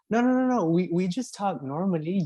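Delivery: high-pitched mimicked protest; retold denial. Americans denying they have an accent, mimicked high protest. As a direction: imitate insistent denial, pitched well up. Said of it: "then they were like".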